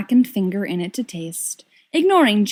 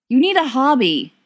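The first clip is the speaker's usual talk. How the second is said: snapping; 5 semitones higher, 4 dB louder than usual. Acted. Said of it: "James snaps".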